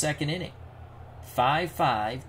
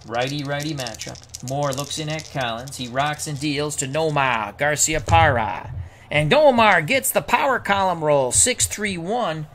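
louder, lifted baseball announcer call; live play-by-play; 2 semitones higher, 5 dB louder than usual. Pretend. Announcer calling the pitch to Nomar Garciaparra, live play-by-play. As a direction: belt out the batter's name and the roll.

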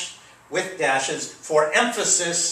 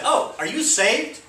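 lightly voiced question, much higher; a hint of Jesus; 10 semitones higher than usual. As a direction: ask it gently, as Jesus. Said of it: "Jesus said".

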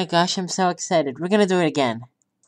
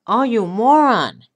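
mock-angry yell at the ball, louder; annoyed yell; 4 semitones higher, 6 dB louder than usual. Real, not acted. Gamer yelling at his ball for rolling off, mock anger. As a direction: scold the ball for falling.

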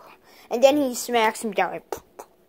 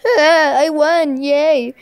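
loud, laughing little cheer; victory cheer; 4 semitones higher, 7 dB louder, slower than usual. Pretend. Winning toy bear cheering over the knocked-out bear, loud and laughing. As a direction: shout it out with glee, higher and laughing.

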